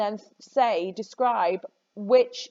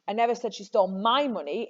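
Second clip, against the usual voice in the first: "my" singled out before "money"; stress on MY. Shown as a demonstration. Teacher shows students how meaning shifts, stressing "my". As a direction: lean hard on "my". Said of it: "It was somebody else's money".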